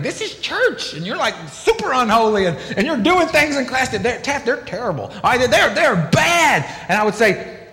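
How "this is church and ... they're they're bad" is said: high, exasperated mock teacher voice, ending in a shout; scolding Bible teacher. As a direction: play the fed-up church teacher complaining about unholy kids. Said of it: "they would say and do things where they think".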